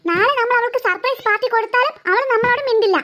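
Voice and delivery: usual speaking voice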